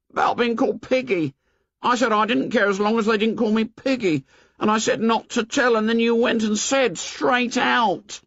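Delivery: put-on high, plaintive boy voice; insist, hurt and left out; Piggy voice. A narrator's reading.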